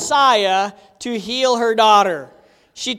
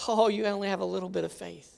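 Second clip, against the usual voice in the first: much softer, quick aside; soft paraphrase; 4 semitones lower, 10 dB quieter, faster than usual. Retold or imitated. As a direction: say it under the breath. Jesus to Peter, paraphrase.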